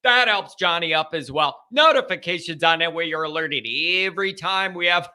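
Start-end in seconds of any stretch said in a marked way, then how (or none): none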